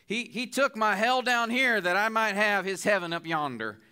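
lightly imitating the old preacher J. Vernon McGee's accent. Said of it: "I don't think I can do justice to his accent".

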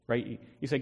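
ordinary delivery

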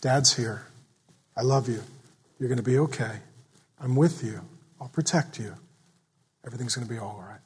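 low and soft, fading to near silence; tender invitation. Retold or imitated. Father soothing his child after a night terror, tender and soft. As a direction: soothe a scared child softly. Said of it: "An invitation is".

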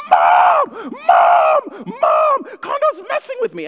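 put-on very high, loud childish shout of Mom; whiny kid yelling for mom. Little brother yelling to mom from the back seat, high shrill shout. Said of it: "driving him crazy".

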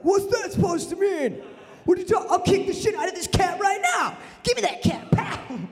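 high-pitched comic fury; mock outraged yelling. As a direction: blow up at the insult, voice way up. Acted outburst at stereotype remark.

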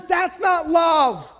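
slow, loud shout on not; emphatic shout. Acted. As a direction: hammer it home with a slow shout. Emphatic denial in sermon.

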